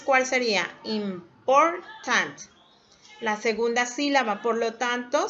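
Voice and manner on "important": very slow "Important", the middle syllable pressed hard; stress on PORtant. Shown as a demonstration. Teacher shows the class which syllable takes the stress. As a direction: drag out "Important" and punch "por". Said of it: "La de mayor énfasis".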